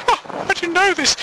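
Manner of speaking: high, mock-wailing question; put-on high widow voice; distraught widow. Widow questions the officer about her husband's death, high wail.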